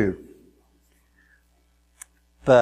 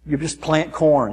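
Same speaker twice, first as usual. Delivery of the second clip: usual speaking voice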